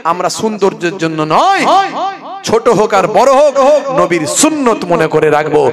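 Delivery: mock preaching; imitated waz sermon. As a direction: preach like a village huzur.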